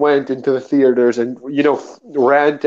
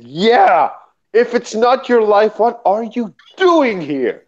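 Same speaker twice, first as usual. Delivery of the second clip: incredulous; 7 semitones higher than usual. Retold or imitated. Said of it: "I said".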